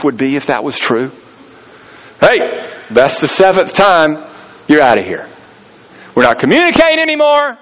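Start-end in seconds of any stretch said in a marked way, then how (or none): 2.2-7.6 mock fed-up cutoff. Mock cutting off seventh offender.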